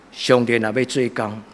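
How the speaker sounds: ordinary talk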